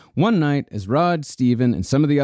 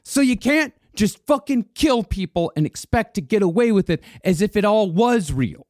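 1 retold yell; 9 semitones higher than usual. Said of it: "He yelled at Rod".

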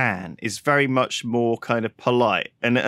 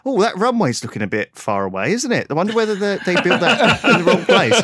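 indirect polite; 5 semitones higher than usual. Retold or imitated. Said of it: "They'd be like".

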